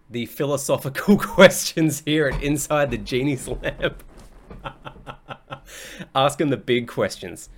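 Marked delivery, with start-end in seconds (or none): 0.1-4.0 laughing. Real, not acted. Laughing at own wordplay. 4.1-6.1 silent laugh. Real, not acted. Keeps laughing.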